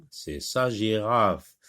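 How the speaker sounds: « sa » appuyé et lent; stress on sa. Shown as a demonstration. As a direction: appuie bien sur « sa ». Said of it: "C'est la girafe de Nora". (accent d'insistance sur « sa »)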